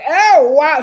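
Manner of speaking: loud, gushing imitation of delight; happy wow. Host imitating Francesca seeing Joseph, gushing. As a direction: burst out, thrilled to see him. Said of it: "she was like".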